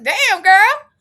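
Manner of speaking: high, loud mock exclamation; retold shout telling a girl to clear her throat; exasperated outburst. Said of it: "I was like".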